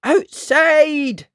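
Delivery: high, loud yell of warning; yelled alarm. A narrator's reading. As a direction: yell it out to alert everyone. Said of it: "Missus Jones yelled".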